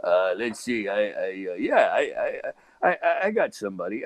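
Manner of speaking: put-on faltering, stammered drunk talk; hammered man. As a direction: lightly imitate a drunk guy fumbling for words. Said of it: "talks just like he's hammered".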